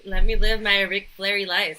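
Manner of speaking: loud showy strut; loud playful brag. Pretend. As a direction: belt it out like a wrestler boasting.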